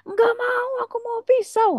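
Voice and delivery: put-on very high, squealing little kid; mock crying child. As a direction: imitate a child's shrill protest. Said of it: "anak Anda udah nangis-nangis".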